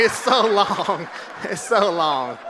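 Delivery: slow, laughing complaint. Real, not acted. Joking to the crowd about the long speech, laughing. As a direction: drag it out with a laugh.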